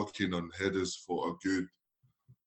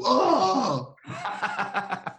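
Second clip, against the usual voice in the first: staged yelp of pain falling over; playacted dive cry; fake dive cry; 10 semitones higher than usual. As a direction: cry out like he's been fouled. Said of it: "I go".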